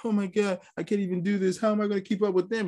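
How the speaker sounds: hammed-up self-pity from a struggling runner; whiny recruit. Third recruit giving up on the run, high whiny mock voice. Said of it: "He's like".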